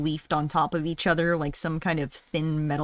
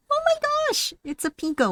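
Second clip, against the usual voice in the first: surprised gasp; 11 semitones higher than usual. Real, not acted. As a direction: gasp then high surprise. Surprised discovery.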